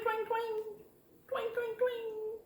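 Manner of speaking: high, softened string noise; light, high guitar imitation; mock guitar twang. Shown as a demonstration. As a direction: twang it on one high note. Demonstrating pretend guitar playing.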